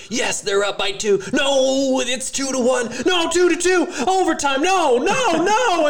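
a fan watching a game cries out in rising panic; panicked fan shouting. Retold or imitated. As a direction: yell at the TV as the lead slips away. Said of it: "I'm always like".

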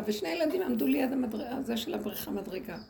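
ordinary talk, nothing marked

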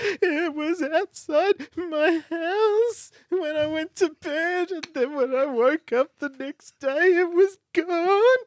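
put-on high, slow blubbering Australian boy; crying boy. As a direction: wail it like a little kid who lost his bike. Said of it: "Crying is just Australian boy".

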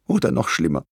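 understated tearful worry; sobbing. A narrator's reading. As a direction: dread the worst, gently acted. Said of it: "schluchzte sie".

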